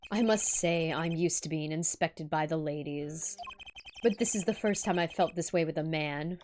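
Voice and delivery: lightly voiced smooth-talking showman; suave low voice. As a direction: purr it like a smug charmer. Reads suave character line.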